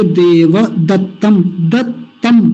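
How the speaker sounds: देवदत्तम with full va, then दत्तम held on the tt; double t. Shown as a demonstration. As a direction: break दत्तम as dat-tam. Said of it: "दो त हैं". (दोनों त स्पष्ट)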